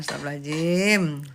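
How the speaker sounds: ordinary talk, nothing marked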